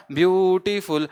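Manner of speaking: ब्यूटीफुल said in three separate parts, ब्यू-टी-फुल; broken into syllables. Shown as a demonstration. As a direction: stretch out each syllable of ब्यूटीफुल. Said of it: "ब्यूटीफुल को अगर आप break करेंगे".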